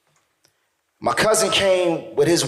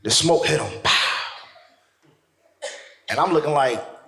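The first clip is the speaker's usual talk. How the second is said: ordinary talk, nothing marked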